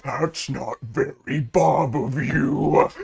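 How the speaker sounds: slow creature voice; slow, simple reproach from a big creature; Bob. Acted. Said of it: "And he goes".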